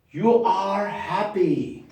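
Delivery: You are happy, each word slow; slow model read; slow dictation. Shown as a demonstration. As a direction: drill it slowly for the class. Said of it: "modelling sentence".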